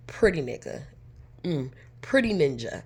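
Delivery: ordinary delivery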